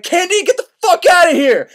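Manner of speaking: high, loud hammed-up warning; old woman urging Candide to flee, mock shout; urgent mock yell. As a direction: yell at him to run, half in jest.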